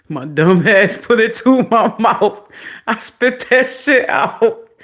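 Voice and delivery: high, loud, laughing outburst; laughing shout. Real, not acted. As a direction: shout it while cracking up at yourself. Laughing at her own disgust.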